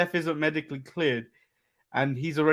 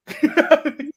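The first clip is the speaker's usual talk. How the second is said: high laugh; 12 semitones higher, 6 dB louder than usual. Real, not acted. Laughs at the segment.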